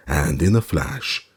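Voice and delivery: plain reading voice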